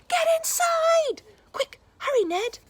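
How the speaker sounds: very high, loud panicked call; urgent shout. Acted. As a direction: yell a warning. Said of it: "The knights all shouted".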